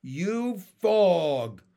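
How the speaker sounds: high, loud, slow proclamation; drawn-out, delighted holler; shouted. A narrator's reading. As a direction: declare it grandly in simple frog talk. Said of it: "shouted Pin".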